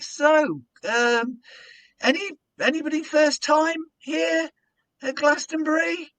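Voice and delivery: high, shouted filler question; desperate crowd work. Retold or imitated. Compere stalling with empty crowd questions, shouting. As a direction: bluff with forced cheer. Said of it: "bollocks like".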